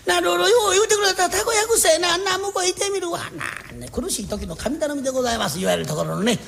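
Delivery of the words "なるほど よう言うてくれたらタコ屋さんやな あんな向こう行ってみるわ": high, bright and pleased; eager agreement. Acted. Friend delighted by the advice, voice pitched high. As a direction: jump at the idea, much higher.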